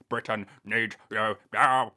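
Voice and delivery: slow, booming patriotic proclamation; hammed-up, distorted loud call to the nation; mock rallying cry. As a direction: bellow it slowly like a wartime appeal. Mock rallying cry ending the show.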